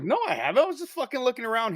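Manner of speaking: high-pitched retold protest; defensive denial. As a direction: protest your innocence, pitch climbing. Retells his baffled denial to Ben.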